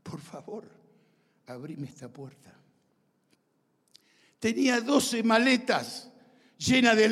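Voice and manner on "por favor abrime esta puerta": soft, halting plea to God; re-enacted hushed supplication; pleading prayer. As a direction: pray it quietly, with pauses between phrases. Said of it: "clamé al Señor".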